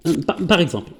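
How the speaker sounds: ordinary talk, nothing marked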